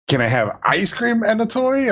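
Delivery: put-on high, sing-song little-kid plea; daughter's cheeky ask. Dad imitating his little daughter asking for ice cream, high kiddie voice. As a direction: squeak it out like a bargaining toddler.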